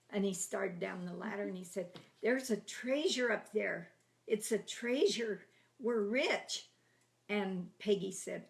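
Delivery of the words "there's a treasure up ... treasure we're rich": excited announcement. Retold or imitated. Brother announces treasure, pretending.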